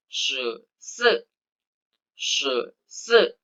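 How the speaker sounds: "Se se" with two falling tones; Mandarin fourteen. Shown as a demonstration. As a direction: model "Se se" tone by tone. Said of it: "empat belas".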